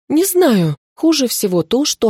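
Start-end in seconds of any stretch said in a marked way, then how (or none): 0.1-0.8 high, insistent exclamation; raised voice of exasperation; cried out. A narrator's reading. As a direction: repeat it as an agitated cry. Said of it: "сорвалась на крик ведьма".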